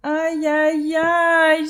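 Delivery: high, loud whining ouch cries; complaining ouch. Acted. Dazed scarab moaning after the fall, high whine. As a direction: moan it like a hurt beetle. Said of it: "se plaint Vladimir".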